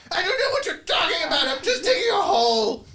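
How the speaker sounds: high, strained, full-voiced holler; distant shouted reply. Digger yells back to the caller from far off, high loud holler. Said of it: "there's a voice going".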